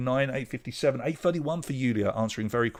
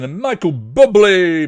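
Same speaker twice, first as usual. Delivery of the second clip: loud stretched showman call of the name; excited reveal; 7 semitones higher, 13 dB louder, slower than usual. Real, not acted. Quiz host reveals the answer.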